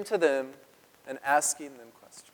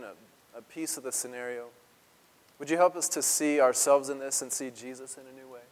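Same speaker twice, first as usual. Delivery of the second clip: prayer; 2 semitones lower than usual. Real, not acted. Prays over the text.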